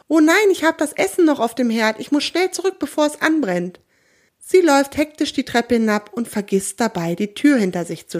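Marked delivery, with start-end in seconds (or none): 0.1-3.8 higher, hasty startled cry; startled hurry. A narrator's reading. Frau Große Nase remembers the stove, a startled hasty cry. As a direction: panic about the burning food. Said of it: "schreckt sie hoch und ruft".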